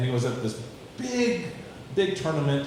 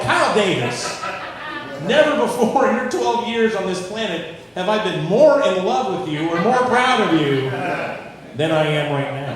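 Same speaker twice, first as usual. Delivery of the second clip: loud, higher, overjoyed praise; proud dad; 6 semitones higher, 8 dB louder than usual. Retold or imitated. Dad proudly praising his son in the car, loud and high. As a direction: swell with mock-solemn pride. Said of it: "I was like".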